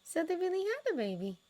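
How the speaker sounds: usual speaking voice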